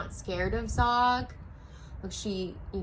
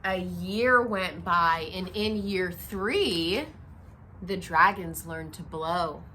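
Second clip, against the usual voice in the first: story narration; 4 dB louder, slower than usual. A narrator's reading. Reading picture book aloud.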